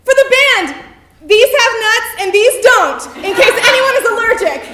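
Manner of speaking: high, loud and fast, shouted; eager fan shouting over the band; shouting over music. Retold or imitated. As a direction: rattle it off at a yell over the noise. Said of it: "I shouted over the same dreadful opening band".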